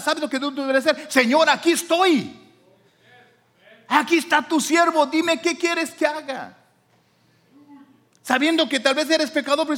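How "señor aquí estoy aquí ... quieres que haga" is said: loud, high, drawn-out declaration; fervent answer. Acted. Servant answering God's call, fervent raised voice. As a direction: cry out slowly in eager surrender.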